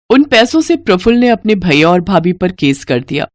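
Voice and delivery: ordinary delivery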